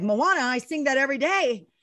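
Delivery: high, excited exclamation; retold delight. Disney fan hearing the rescue dog's name, delighted. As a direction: gush at the coincidence, pitch soaring.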